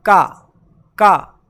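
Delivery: का said loud and clipped; short ka sound; phonic k sound. Shown as a demonstration. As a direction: drill का twice. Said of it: "के को क्या पढ़ते हैं".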